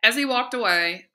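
usual speaking voice

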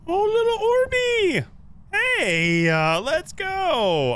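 high, loud excited squeal. Real, not acted. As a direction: squeal with delight at the orb. Excited at ghost orb.

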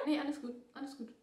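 ordinary delivery

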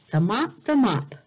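normal talking voice

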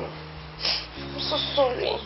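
slow, breathy whisper of sorry; a slow, hushed whisper to the hurt sister; whispered apology. Acted. Comforting Amaka after the beating.

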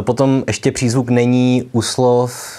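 ordinary delivery

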